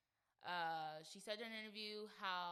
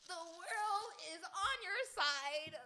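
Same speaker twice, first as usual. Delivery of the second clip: mock sympathy; 10 semitones higher, 7 dB louder, much slower than usual.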